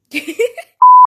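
squealing laugh at own blunder; high shriek of laughter. Real, not acted. Host cracking up at her blooper, shrieking laugh.

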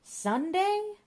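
high, loud yelp of disbelief; a high, loud shriek of "Sunday?"; shocked outburst. Acted. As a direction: blurt it out in total shock.

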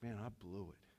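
low, very soft admission; dejected regret. Acted. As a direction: drop the voice right down, defeated. Peter's regret after the denial, voiced.

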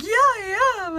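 high-pitched imitation of the bride; giddy bride.

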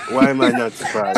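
laughing through the words; amused, laughing. Real, not acted. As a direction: tease a friend through real laughter. Laughing at co-host's story.

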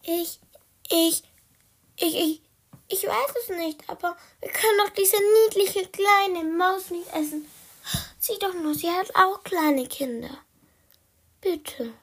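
high, stammering plea; imploring little-cat voice; pleading. Acted. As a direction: beg for mercy in a small high voice, hesitant at first. Pleads to spare the mouse.

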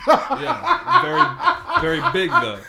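high squealing laugh through words; cracking up mid-sentence. Real, not acted. Laughing at Big E joke.

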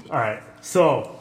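ordinary talk

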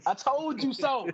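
drawn-out, high-pitched gloat; bragging. Real, not acted. Just here to brag to the doubters, gloating. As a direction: rub it in slowly, voice up high. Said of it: "you know, bragging".

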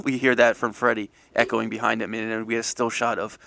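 normal talking voice

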